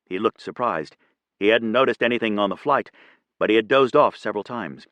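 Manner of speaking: usual speaking voice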